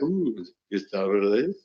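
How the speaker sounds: slow, drawn-out put-on voice; Gustavo Reyes. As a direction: stretch the name out, as an impersonation. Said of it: "we're pretending to be Gustavo".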